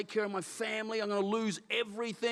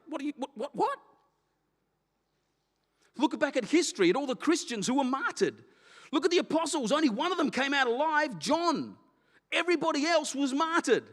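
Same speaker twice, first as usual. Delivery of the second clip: loud, higher preaching voice; full-force preaching; 9 semitones higher, 6 dB louder than usual. Acted.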